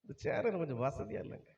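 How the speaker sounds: soft, fast spoiled whining; lazy complaining. Retold or imitated. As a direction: whine softly like a pampered new king. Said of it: "அவன் என்ன செய்யறான்?".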